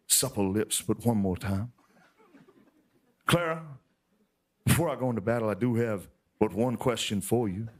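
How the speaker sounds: lightly hammed-up sweetheart letter reading; mock love letter. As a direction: read it as a hint of a lovesick soldier. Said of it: "I have here a love letter".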